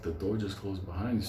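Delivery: low, quiet incredulous echo; disbelieving. Retold or imitated. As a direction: repeat it in flat disbelief. Incredulous echo of her words.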